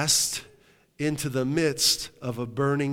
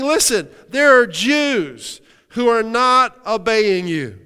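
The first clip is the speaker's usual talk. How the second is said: higher, louder proclaiming voice; loud preaching; 8 semitones higher, 8 dB louder than usual. Acted. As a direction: call it out to the whole hall. Preaching the accusation.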